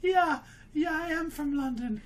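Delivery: put-on high, laughing London accent; estuary English accent. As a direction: play up a cheeky Londoner. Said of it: "You sound like you're from London".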